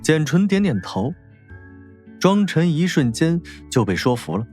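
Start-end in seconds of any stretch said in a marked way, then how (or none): none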